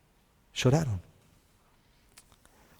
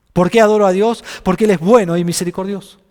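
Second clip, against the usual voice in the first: ordinary talk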